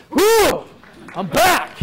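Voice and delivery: high, loud burst of relief; relieved whew. Retold or imitated. Reliving the weigh-in to the audience, a loud relieved whew. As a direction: let the relief burst out as you celebrate. Relief after losing nine pounds.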